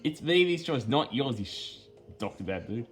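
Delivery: ordinary talk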